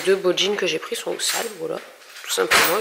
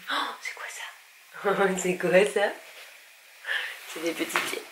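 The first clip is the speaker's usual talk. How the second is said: whispering over a crib; 3 semitones lower, 9 dB quieter, slower than usual. Real, not acted. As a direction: hush it, breathe the words slowly. Waking the baby in the crib. (chuchoté au-dessus du berceau)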